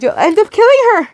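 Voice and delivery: very high, desperate protest; begging cry. A narrator's reading. Daughter begging the man cutting her mother, desperate cry. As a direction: cry it out in panic. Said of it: "You begged".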